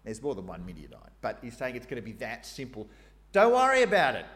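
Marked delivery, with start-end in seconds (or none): 3.3-4.3 loud, high, breezy reassurance; casual paraphrase. Pretend. Preacher paraphrasing the Lord, loud and breezy. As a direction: toss it off loudly, no big deal. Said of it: "That's my translation".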